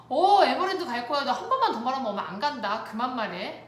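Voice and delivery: re-enacted parent losing patience; irritated warning. Mother to child asking about Everland repeatedly, irritated.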